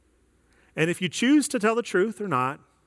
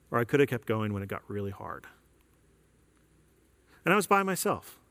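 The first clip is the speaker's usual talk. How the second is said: ordinary talk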